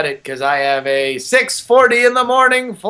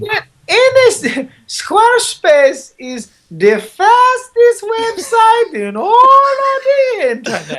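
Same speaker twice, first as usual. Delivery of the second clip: much higher, slow, hammed-up Spanish accent; terrible Spanish accent; 4 dB louder, slower than usual. To his co-host, ad read in a squealing mock Spanish accent. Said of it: "the terrible accent".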